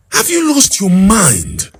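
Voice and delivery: high, loud, outraged roar of a father; thundering father. Acted. The father thunders at his son, high outraged roar. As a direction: bellow it at your wayward son.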